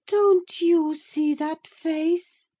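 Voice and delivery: high, slow and searching; earnest Catherine. A narrator's reading. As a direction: press the question, eyes fixed on the mirror. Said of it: "she inquired, gazing earnestly".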